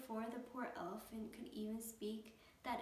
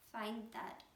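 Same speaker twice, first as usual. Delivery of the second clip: ordinary delivery, nothing marked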